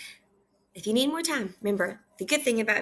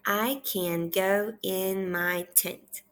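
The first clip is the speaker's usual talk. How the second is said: half-speed, word by word; measured repeat-after-me reading; slow read-aloud; much slower than usual. Shown as a demonstration. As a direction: model each word separately for a young reader. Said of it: "I want you to repeat after me".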